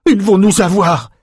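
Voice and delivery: high, loud cry of panic; panicked cry. A narrator's reading. Brock panics as reinforcements rush in, crying out. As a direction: cry out as if about to be caught. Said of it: "cria Brock".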